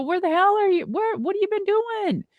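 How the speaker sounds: shocked delight at hearing from him; surprised. Retold or imitated. Deuce answering Mike's surprise call, astonished.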